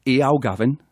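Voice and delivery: Geordie greeting, demonstrated; Geordie accent. Shown as a demonstration. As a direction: show the Geordie vowels on the greeting. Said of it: "the little phrase is".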